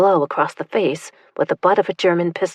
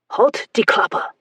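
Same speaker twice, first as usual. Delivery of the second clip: yelled in German; 5 semitones higher, slower than usual. Acted. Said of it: "the soldier yelled in German".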